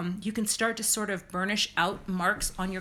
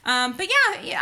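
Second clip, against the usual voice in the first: excited yeah; 10 semitones higher, 7 dB louder than usual. Real, not acted. Sudden high burst.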